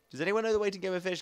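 ordinary delivery

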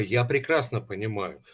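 ordinary talk, nothing marked

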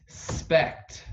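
normal talking voice

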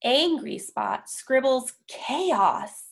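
usual speaking voice

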